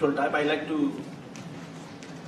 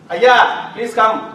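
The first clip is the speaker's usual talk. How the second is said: louder, higher, curt summons; disrespectful; 4 semitones higher, 8 dB louder, much slower than usual. Shown as a demonstration. Teacher demos the same words disrespectfully. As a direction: call them in with no respect.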